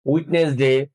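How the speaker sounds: ওয়েডনেসডে as three-syllable "wed-nes-de". Shown as a demonstration. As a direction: say ওয়েডনেসডে quickly with the middle "nes" voiced. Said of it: "এইভাবে উচ্চারণ করে থাকি".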